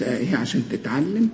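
ordinary talk

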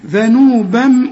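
ذنوبا slowly, ending in a held M hum; tanween merged into M. Shown as a demonstration. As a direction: close ذنوبا on a long "bamm". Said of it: "التنوين ده ادغمه في الميم".

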